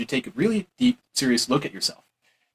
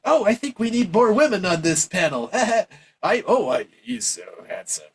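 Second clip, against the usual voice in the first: higher put-on voice with a silly laugh; mock voter voice; 6 semitones higher than usual. Voter wanting more women on the panel, mocking gush. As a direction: lampoon a gushing, shallow voter.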